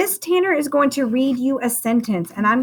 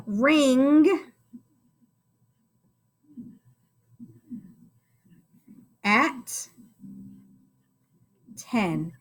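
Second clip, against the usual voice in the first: very slow, one word at a time, long pauses; word-by-word writing; much slower than usual. Shown as a demonstration. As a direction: spell the sentence out as you write it. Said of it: "Nice space between my words".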